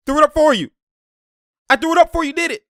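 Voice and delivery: high, loud player's shout; calling out to teammates. Acted. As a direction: yell it across the court. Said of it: "So he's letting them know".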